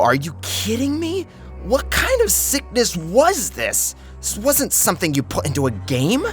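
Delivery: ordinary delivery, nothing marked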